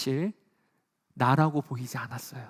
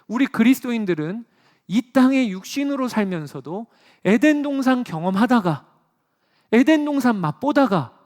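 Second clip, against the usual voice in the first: higher, louder pulpit voice; fervent preaching; 6 semitones higher, 7 dB louder than usual. Acted. Pastor tells believers of tasting Eden, higher and louder. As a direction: proclaim it boldly.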